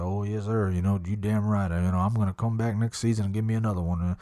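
Yellowstone cowboy drawl. Retold or imitated. Promising the guide another hunt, low cowboy drawl. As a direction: play yourself as a swaggering cowboy. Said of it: "My whole voice changed".

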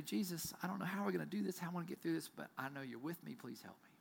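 soft prayer fading into a whisper; quiet prayer. Retold or imitated. Pastor voicing a humble prayer to Jesus, fading to a whisper. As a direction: pray gently, trailing to a hush.

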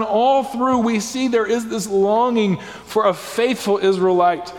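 normal talking voice

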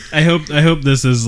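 ordinary talk

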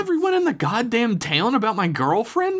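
high-pitched frustrated demand; exasperated yell with pitch way up; teeming frustration. A narrator's reading. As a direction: confront mother, frustration spilling over every word. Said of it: "with that frustration teeming over the edge".

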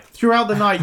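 usual speaking voice

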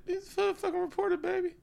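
very high put-on squeaky voice; cartoonishly high character voice.

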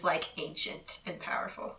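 whispered, conspiratorial aside; hushed whisper. Real, not acted. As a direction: drop to a secretive whisper.

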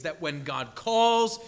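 ordinary talk

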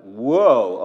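loud sudden whoa; surprised whoa. Retold or imitated. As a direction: blurt it out in shock. Retold surprise.

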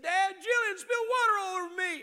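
put-on high, loud complaining child; whiny kid. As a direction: play the tattling little boy. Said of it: "he said, he said".

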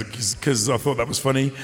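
ordinary talk

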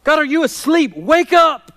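high, loud confrontational plea; demanding cry. Acted. Suffering godly man calling on God to wake up, loud demand. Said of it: "What he's saying, in essence, is".

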